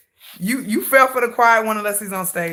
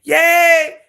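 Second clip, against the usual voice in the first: ordinary talk, nothing marked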